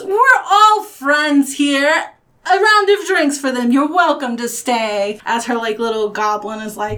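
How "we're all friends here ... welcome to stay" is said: higher, louder welcoming call; hearty host. Acted. Julie buying the strangers drinks, loudly welcoming. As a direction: announce it grandly to the room. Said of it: "And Julie goes".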